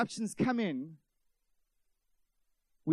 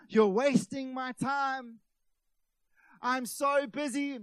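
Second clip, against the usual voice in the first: mock-indignant, higher, louder and drawn out; self-important complaint; 5 semitones higher, 6 dB louder, slower than usual. Preacher imitating busy Westerners, mock self-important. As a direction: stretch it out in exaggerated self-importance. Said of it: "That's how we speak".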